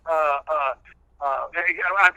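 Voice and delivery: ordinary talk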